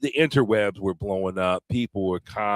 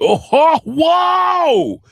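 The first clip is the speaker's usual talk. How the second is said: hype shout; 10 dB louder, much slower than usual. Acted. Hype shouting at show open.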